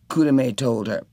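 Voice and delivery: ordinary delivery, nothing marked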